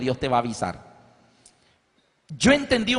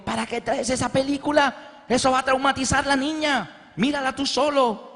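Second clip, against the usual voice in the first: higher, reproachful; scolding wife; 7 semitones higher than usual. Retold or imitated. Wife scolding husband from the kitchen, mock reproach. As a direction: scold him lightly. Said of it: "Mi esposa ... dijo".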